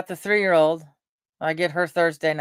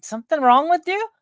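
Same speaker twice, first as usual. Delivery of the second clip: much higher, baffled disbelief; mock incredulous; 12 semitones higher, 4 dB louder than usual. Old-timer hearing new slang, mock incredulous. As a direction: recoil at the weird slang. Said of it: "They'd be going".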